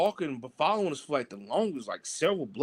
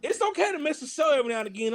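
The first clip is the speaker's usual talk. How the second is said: higher, fast motherly advice; a light impression of a mom, higher and quick; imitating mom; 8 semitones higher, faster than usual. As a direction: breeze through it like a gently advising mom. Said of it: "my mom was like".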